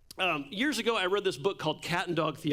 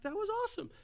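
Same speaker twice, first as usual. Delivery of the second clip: amazed; 5 dB quieter, much faster than usual. Retold or imitated. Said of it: "And I was like".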